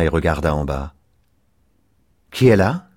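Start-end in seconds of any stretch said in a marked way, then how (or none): 2.3-2.9 higher, louder call from the balcony. A narrator's reading. Girl on the balcony calling down to a stranger, loud call. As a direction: call down into the night. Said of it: "lança-t-elle".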